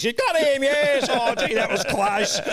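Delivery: high, loud overexcited commentator; Bill Lawry. Retold or imitated. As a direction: shout it like a one-eyed fan caller. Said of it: "Bill Lawry".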